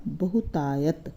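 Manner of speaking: बहुतायत slowly as one whole word; बहुतायत, drawn out, no break. Shown as a demonstration. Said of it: "इस तरह से पढ़ेंगे इसको". (बहुतायत को एक साथ धीरे से बोलें)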